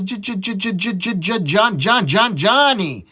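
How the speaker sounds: high stuttering schoolboy. Acted. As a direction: stammer repeatedly on the first sound. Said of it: "you have a stutter or something".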